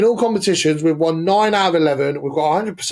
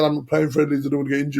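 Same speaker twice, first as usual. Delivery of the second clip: put-on French accent; 2 semitones lower, much faster than usual. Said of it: "but he said it in a French accent".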